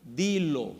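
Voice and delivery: "Dilo" said soft and offhand, not a command; a preacher contrasts a casual "Dilo" with "Ordéname". Shown as a demonstration. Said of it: "Lo que le dijo fue: "Dilo".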